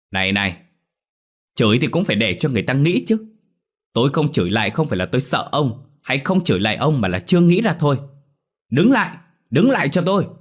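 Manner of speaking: rising into a high, loud yell; shrill protest. A narrator's reading. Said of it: "mồm choe choé".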